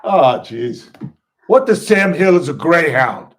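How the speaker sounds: loud, high, drawn-out disbelief; incredulous barman, slow and loud; exasperated Philly bartender. Retold or imitated. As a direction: balk at a fancy drink order. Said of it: "And the guy goes".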